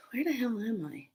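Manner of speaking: hushed, whispered retold confusion; whispering in bewilderment; bewildered whisper. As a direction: whisper it as if lost.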